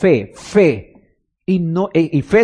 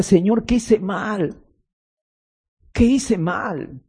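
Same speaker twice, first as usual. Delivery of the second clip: slow, softer plea to God; mild retold lament; 3 semitones higher, 4 dB quieter, much slower than usual. Worship leader asking the Lord what went wrong, slow and soft. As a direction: ask it slowly, as if at a loss. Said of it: "me sentía mal".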